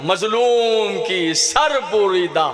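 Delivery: slow, long-drawn mournful recitation; majlis lament. Acted. Reciter mourning a trampled body to mourners, slow lament. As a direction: stretch each word out in lament.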